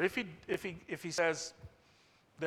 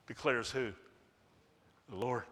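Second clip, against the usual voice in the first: ordinary delivery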